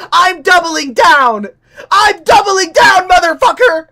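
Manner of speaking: a gleeful, slow defiant roar; defiant shout. Real, not acted. Playful defiance at chat.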